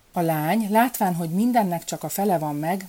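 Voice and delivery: ordinary delivery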